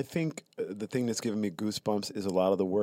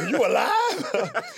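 incredulous; 9 dB louder, much slower than usual. Retold or imitated. Mobile folks asking, incredulous.

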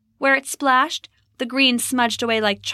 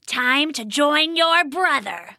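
slow, higher sinister goblin voice; menacing goblin; 5 semitones higher, slower than usual. A narrator's reading. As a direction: gloat slowly over your victim. Goblin menaces fallen Rose.

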